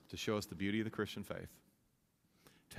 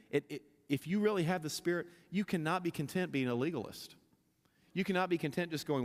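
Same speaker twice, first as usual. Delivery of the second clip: preaching at full force; 6 semitones higher than usual. Acted. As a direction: preach it with rising fire. Preaching builds up and louder.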